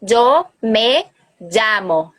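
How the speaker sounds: "Yo me llamo" lento, com "llamo" em som de DJ; llamo com dois L. Shown as a demonstration. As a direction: diga "Yo me llamo" devagar, marcando o LL. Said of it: "diferença também nos dois L's". ("llamo" lento, com LL em som de DJ)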